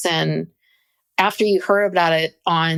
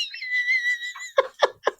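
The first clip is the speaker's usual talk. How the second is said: a high squealing burst of laughter; high laughing shriek; 6 dB quieter than usual. Real, not acted. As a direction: let out a real squeal of a laugh. Bursts out laughing at own joke.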